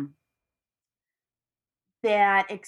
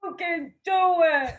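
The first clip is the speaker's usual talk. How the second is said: mock cheering; 8 semitones higher than usual. Echoes cheer with laughing co-host.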